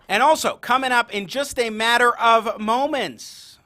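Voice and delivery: loud, punchy show teaser; radio host tease. Acted. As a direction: tease the next segment with energy.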